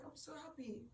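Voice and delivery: high, soft, overjoyed voice; retelling a tearfully happy moment; overcome with happiness. Retold or imitated. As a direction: say it softly, high with happy emotion. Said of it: "but I was happy".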